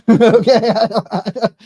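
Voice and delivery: real laugh bursting through the words, loud and high; helpless laughter breaking up the words. Real, not acted. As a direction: crack up while protesting. Laughs at garbled chat message.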